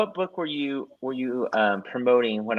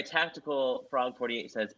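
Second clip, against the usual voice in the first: ordinary talk, nothing marked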